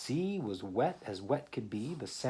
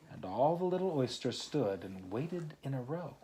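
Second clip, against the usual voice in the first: lively read-aloud poem voice; story reading; 5 semitones higher, 4 dB louder than usual. Acted. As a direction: read the rhyme to a small child. Reads poem aloud to child.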